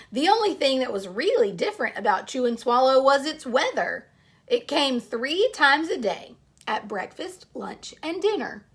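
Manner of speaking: storybook read-aloud to a class; story narration. Acted. Teacher reading a picture book to her class, storytelling voice.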